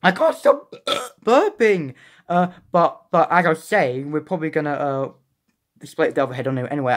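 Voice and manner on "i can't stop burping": slow, hiccuping through a burp. Real, not acted. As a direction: talk through burps. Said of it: "Sorry, I keep burping".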